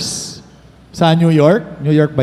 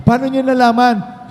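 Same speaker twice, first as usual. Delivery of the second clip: high-pitched acted amazement; amazed question; 7 semitones higher than usual. Castaway to the rescue team, acted amazement. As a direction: ask high, as if amazed to be found.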